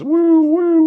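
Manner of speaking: high, loud woo-woo of a police siren; imitated siren. Telling the friend about sirens, mimicking a high loud siren. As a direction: wail it like a cop car siren.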